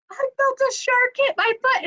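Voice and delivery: high, frightened voice; shaken husband. Retold or imitated. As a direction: report it in a panicked squeak. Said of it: "he said".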